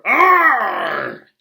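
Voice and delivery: loud high cry of fright; boy treading on something alive; startled yell. Acted. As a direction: yelp in shock. Harry startled at doormat.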